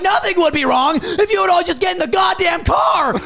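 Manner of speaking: high, loud yell at the grapes; furious shout. Acted. Fed-up [name] yelling at his family to get in the car. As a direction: blow up at them, full-throated. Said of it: "And I'm like".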